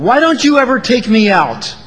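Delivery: blaming nag; unkind complaint. Shown as a demonstration. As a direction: whine it like a fed-up spouse. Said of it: "the other is unkind".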